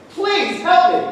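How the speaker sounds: beg, voice lifted; begging. Acted. Farmer begs the Reverend for help, pleading.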